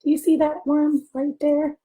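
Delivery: ordinary delivery, nothing marked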